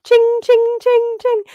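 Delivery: high, ringing "ching" on repeat; loud, high-pitched mechanical chinging; cymbal monkey imitation. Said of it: "just like the monkey with cymbals".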